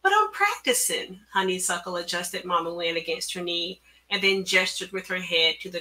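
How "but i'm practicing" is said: high-pitched protest; pitched-up, objecting reply. A narrator's reading. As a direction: plead your case to the boss. Honey protests to her boss.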